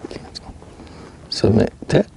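normal talking voice

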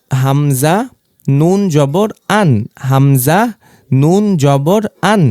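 slow spelling drill, "আন" closed tightly on the ন; closed syllable demo. Shown as a demonstration. Teacher showing students how to read sukun, slow and clipped on "আন". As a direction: spell "হামজা নুন জবর" slowly, then shut the voice on "আন". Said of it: "তো বদ্ধ উচ্চারণটা হচ্ছে".

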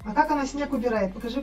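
ordinary delivery

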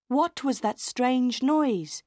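usual speaking voice

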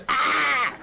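high, loud burst of frustration "Ah!"; exasperated cry. Retold or imitated. Teacher exasperated at the client, loud cry. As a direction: let out a sudden frustrated yell. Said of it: "I thought, it was".

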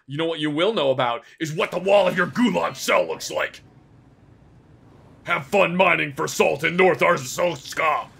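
loud, hammed-up threat; menacing taunt. Inspector condemns traveller to the gulag, loud taunt. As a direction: send them off with mock fury.

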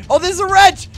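high, loud delight; excited discovery. Real, not acted. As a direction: exclaim at the lucky find. Finds needed item.

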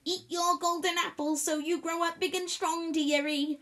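high, wavering granny voice; old granny voice. Pretend. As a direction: scold like a sweet old granny. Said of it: "try on my best old granny voice".

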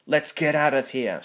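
loud, high urgent shout; panicked shout. A narrator's reading. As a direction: yell it and flee. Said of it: "shouted Bert".

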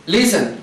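"Listen" stressed on LIS, falling away after; stress on first syllable. Shown as a demonstration. Teacher showing where the accent is, "Listen" stressed at the start. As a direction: hit the start of "Listen", let the end drop. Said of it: "Lo bajo".

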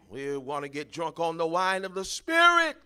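loud shouted proclamation; preaching shout. Acted. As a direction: shout it to the church.

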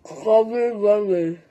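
cheeks crammed, words barely shaped; mouth full. Real, not acted. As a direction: mumble through a packed mouth.

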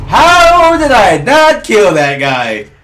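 loud, slow wail of disbelief; incredulous gamer holler; exasperated shout. Real, not acted. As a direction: drag it out loudly, starting high and falling. Misses kill in game.

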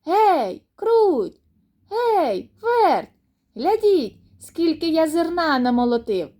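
high, loud rooster call; proud, ringing call-out; hollering. A narrator's reading. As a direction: holler to friends across the yard. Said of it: "Скінчив Півник молотити і гукнув".

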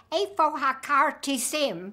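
put-on Hebrew in a heavy Brooklyn accent; slow, loud Brooklyn-accented Hebrew; Aliza's Brooklyn accent. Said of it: "had a terrible, uh, Brooklyn accent".

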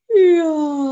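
loud stretching yawn; big yawn. Acted. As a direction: let out a huge sleepy yawn.

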